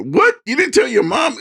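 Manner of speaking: hammed-up uncle catching the kid out; mock disbelief. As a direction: pitch leaps in theatrical shock. Uncle's incredulous question in story.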